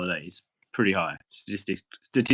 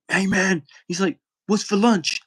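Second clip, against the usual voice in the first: higher, pushy voice of the guy asking; impatient inmate; 9 semitones higher than usual. Retold or imitated. Inmate at the glass asking the server, higher and pushy. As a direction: demand it like a bored prisoner.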